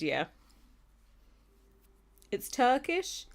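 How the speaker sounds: ordinary talk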